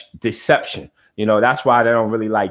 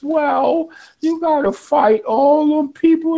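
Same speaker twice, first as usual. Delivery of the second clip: much higher, put-on whiny voice; mock scared voice. Host parodying people with a scared mentality, high mock voice. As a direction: parody the fearful crowd, squeaky and high.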